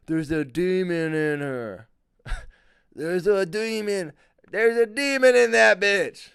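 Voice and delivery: hammed-up shout, high and slow; drawn-out, over-the-top alarm at a demon; horror-movie exorcist. Comic mimicking horror movies, hammed-up alarm.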